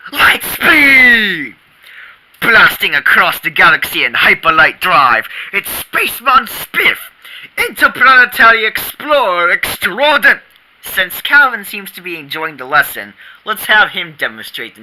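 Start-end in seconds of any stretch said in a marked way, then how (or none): 0.1-10.4 loud space hero announcer; dramatic announcer. Acted. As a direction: narrate like a sci-fi trailer. Spaceman Spiff daydream narration.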